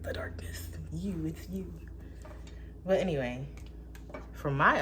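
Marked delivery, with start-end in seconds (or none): 0.0-1.7 whispered, softer; eerie whisper; spooky whisper. Acted. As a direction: hush it like a ghost story. Spooky reveal to listener.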